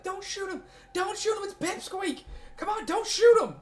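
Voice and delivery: screaming plea. Retold or imitated. Game master voices Brainiac screaming at Ven, high frantic plea. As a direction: shriek it high, begging them to stop. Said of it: "he was screaming, like".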